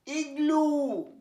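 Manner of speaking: ইগলু very slow, each sound clear; careful pronunciation. Shown as a demonstration. Teacher reading a vocabulary word, very slow. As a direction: stretch ইগলু slowly. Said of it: "আমি পড়ে যাচ্ছি ওয়ার্ডগুলো".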